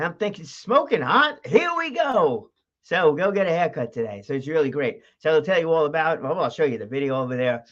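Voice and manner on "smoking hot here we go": high, stretched-out excited cry; excited hype. Acted. Host hyping up his haircut trip, excited. Imagined excited reply to call.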